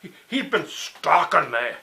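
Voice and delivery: mock urgent warning to her; a half-voiced Bill warning her to watch out; Bill's warning.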